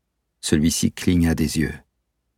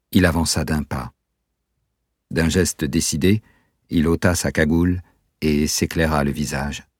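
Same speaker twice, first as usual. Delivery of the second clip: plain reading voice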